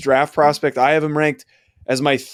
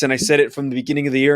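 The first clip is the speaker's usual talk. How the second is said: usual speaking voice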